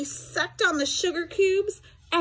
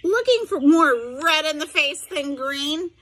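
ordinary delivery, nothing marked

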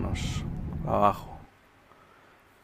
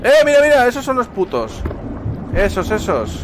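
much higher, loud mira mira; excited shout; 11 semitones higher, 6 dB louder than usual. Real, not acted. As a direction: point them out urgently. Spots creatures, excited. (gritando emocionado)

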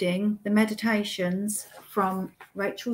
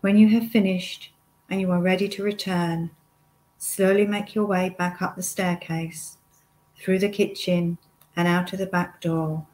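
guided meditation. Acted. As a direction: lead the listener step by step, unhurried, pausing often.

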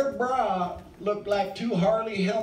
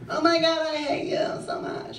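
mock flirty hate; 7 semitones higher than usual. Flirty fake hate quote repeated.

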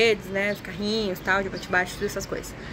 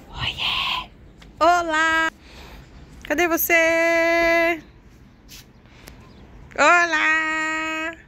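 yelling across the room; 7 semitones higher, 4 dB louder, much slower than usual. Real, not acted. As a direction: call out to find someone. Calling out for child in house.

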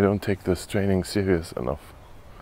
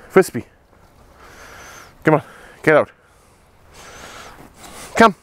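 high excited urging; 7 semitones higher, 5 dB louder than usual. Real, not acted. Urging dog, pitch jumps.

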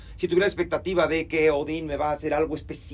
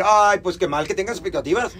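mock reproach; 3 semitones higher, 6 dB louder than usual. Acted scolding reply he rejects.

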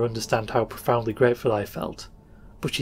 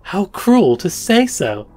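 high, loud, slow exclamation of dismay; hurt reproach; 7 semitones higher, 7 dB louder, slower than usual. A narrator's reading. Girl reproaches the stranger for his words, hurt. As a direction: draw it out as a wounded protest.

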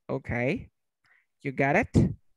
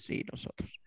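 very soft, voiceless answer; whispered group reply; 13 dB quieter than usual. Acted. Teacher answers for the students, whispered.

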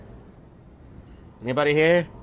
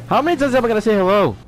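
exasperated; 9 semitones higher, 6 dB louder, faster than usual. Real, not acted. Exasperated outburst.

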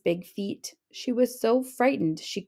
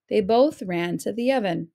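ordinary delivery

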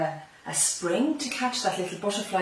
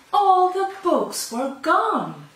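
dramatic surprise; 10 semitones higher, 8 dB louder, much slower than usual. A narrator's reading. Story climax, sudden discovery.

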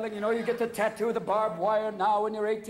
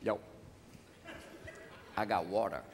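much lower, softer hushed dealer pitch; low street dealer; 11 semitones lower, 6 dB quieter than usual. Retold or imitated. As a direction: sell water on the sly like a street hustler. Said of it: "People be going".

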